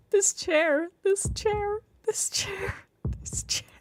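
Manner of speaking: high chant fading to a whisper; fixated muttering that dies away; obsessive rant. A narrator's reading. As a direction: start strained, run out of voice.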